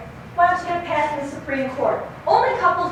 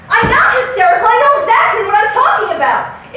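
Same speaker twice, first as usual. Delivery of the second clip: higher and much louder, shrill denial; hysterical protest; 6 semitones higher, 6 dB louder than usual. Acted. Wife shouting back after being called hysterical. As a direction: deny it at full volume. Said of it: "you're hysterical".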